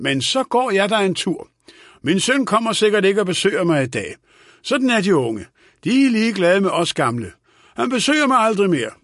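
put-on high-pitched old man's lament; complaining old man. A narrator's reading. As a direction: grumble that the young neglect the old. (klagende gammel mand, højt toneleje)

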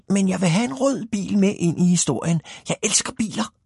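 high-pitched, excited storybook character; small car-lover's excited high voice; eager little hero. Acted. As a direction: pipe up high with childlike eagerness.

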